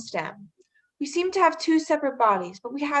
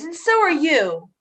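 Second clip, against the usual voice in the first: louder, slow, bitter accusation; accusing retort; 4 semitones higher, 5 dB louder than usual. Acted. Helena to Hermia, bitterly accusing her of the joke. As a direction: lean hard on every word.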